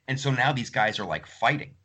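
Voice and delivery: usual speaking voice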